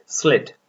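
स्लिट said slit, short i; model pronunciation. Shown as a demonstration. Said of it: "pronounce करें". (स्लिट का साफ़ उच्चारण)

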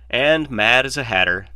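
bright, loud and cheerful; a cheery self-mocking quip. A narrator's reading. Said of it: "Malcolm said cheerfully".

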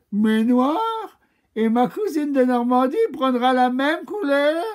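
a prim cow listing her colors; high cow voice. Acted. The cow chats to Lady Chameleon in a high, dainty voice.

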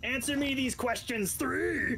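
high, theatrical challenge; hammed-up high quest challenge; Monty Python bridgekeeper. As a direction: intone it like a riddle keeper. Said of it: "Oh, Monty".

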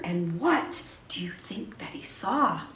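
put-on breathy secret whisper to the kids; suspense whisper. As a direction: lean in and whisper the question. Suspense before reveal to kids.

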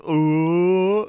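high, loud drawn-out "ooh"; mock howl. Imitating himself on a phone call.

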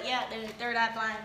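put-on low, casual Southern accent; lightly put-on Southern voice. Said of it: "your impression is Southern".

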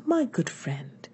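soft, harmonious, unhurried tone; gentle and harmonious. A narrator's reading. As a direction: say it gently and musically, taking your time. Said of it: "in a gentle and harmonious tone of voice".

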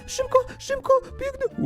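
tiny high-pitched frantic chatter; urgent hushed hurry. Pretend. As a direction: squeak it out as you dash past. Running past dragons in the game. (wysoko, w panicznym pośpiechu)

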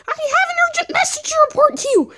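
very high, fast squeaky voice; rushed, high-pitched plea; urgent little mushroom. Acted. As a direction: report urgently as a tiny creature.

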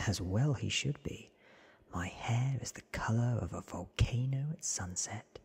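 usual speaking voice

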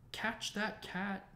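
high, stretched-out calling yell. A narrator's reading. Ron calls to the room as the cat escapes, yelling. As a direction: call out the order, high and slow. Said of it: "Ron yelled".

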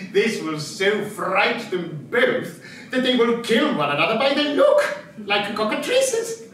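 ordinary talk, nothing marked

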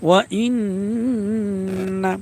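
ইন্না with a very long nasal hum on the n; slow ওয়া ইন্না, doubled n held; obligatory ghunnah. Shown as a demonstration. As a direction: hum through the n before releasing ইন্না. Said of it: "নুনে তাসদিদ ওয়াজিব গুন্না".

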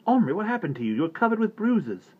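high, strained worried voice; worried father. A narrator's reading. Father to bruised son, high worried questioning. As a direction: fret over the boy's bruises.

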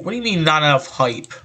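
ordinary talk

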